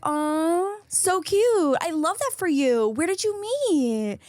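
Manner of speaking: put-on high, cooing baby talk; syrupy fake-nice cooing; talking like to a puppy. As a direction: coo as if to a puppy. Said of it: "And I was like".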